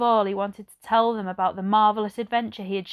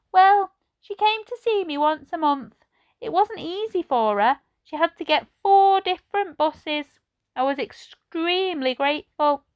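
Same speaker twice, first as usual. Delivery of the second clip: put-on old lady voice; 8 semitones higher, 5 dB louder than usual. A narrator's reading. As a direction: voice her like a gentle elderly lady. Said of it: "Edna's reply".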